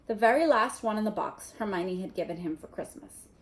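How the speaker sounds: ordinary delivery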